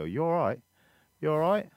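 high, loud English cousins' greeting; imitated English accent. Cousins greeting every ten seconds, imitated English accent. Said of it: "the big thing over there".

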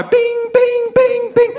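very high imitated message pings; phone ping sounds. Pastor mimicking his phone flooding with messages. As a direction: chirp it like a phone alert.

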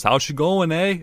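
mock Canadian twang on eh; a deliberately terrible Canadian impression; worst Canadian accent. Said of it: "In his worst, like, Canadian accent he can".

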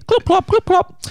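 high, loud clip clop hoofbeats; clicking like horse hooves. Acted. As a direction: trot it out like a horse.

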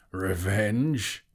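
loud, incredulous dragon question; scornful snort. A narrator's reading. Smaug throws the hobbit's word back, snorting scornfully. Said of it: "he snorted".

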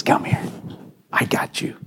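soft, hushed reassurance; Jesus comforting one crying out, in a tender whisper. Retold or imitated. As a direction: whisper it like catching someone.

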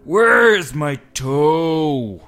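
very slow, loud, high monster wail; groaning monster. A narrator's reading. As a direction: stretch every word like a ghoul closing in. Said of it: "it groaned".

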